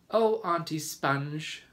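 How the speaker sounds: put-on high, slow, loud child's plea; pleading cry. A narrator's reading. James begging his aunt, crying out. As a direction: cry out like an upset little boy. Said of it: "James cried out".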